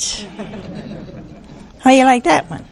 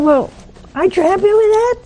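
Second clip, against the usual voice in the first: exaggerated disbelief; 11 semitones higher, faster than usual. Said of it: "I said".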